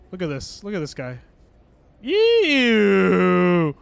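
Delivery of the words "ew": long, loud falling "eeew"; disgusted squeal. Real, not acted. Disgust at something in the game.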